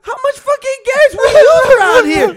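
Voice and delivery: high whiny mock outrage, drawn out; imitating Cedro. Roommate mocking Cedro's shock at the gas bill, high whine. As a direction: squeal out the disbelief like the roommate. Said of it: "And Cedro's like".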